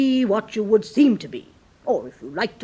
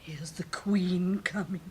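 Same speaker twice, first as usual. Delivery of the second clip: whisper; 3 semitones lower, 8 dB quieter than usual. Acted. Duchess warning Alice the queen approaches, whispering. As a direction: whisper the warning before she arrives.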